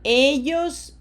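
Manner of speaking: slow Spanish model with long held I sounds; lengthened vowels. Shown as a demonstration. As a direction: draw out each vowel for the learner. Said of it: "it is easier to lengthen the vowels".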